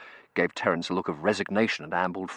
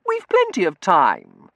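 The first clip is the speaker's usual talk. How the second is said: slow, high, loud assurance; drawn-out, high-pitched wheedle; breezy girlish insistence; 9 semitones higher, 11 dB louder, slower than usual. A narrator's reading. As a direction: brush off the objection girlishly. Girl overrules her cousin.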